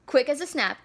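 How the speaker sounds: ordinary delivery, nothing marked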